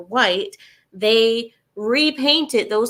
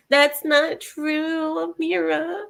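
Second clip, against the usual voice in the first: high, slow whiny mimic; mocking naysayer; 7 semitones higher, much slower than usual. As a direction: drag it out like a skeptic.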